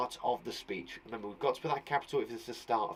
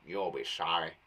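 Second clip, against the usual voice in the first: low, growled threat in a rough voice; angry snarl; 9 semitones lower, 4 dB quieter than usual. Acted. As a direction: snarl it at someone who wronged her. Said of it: "said it angrily".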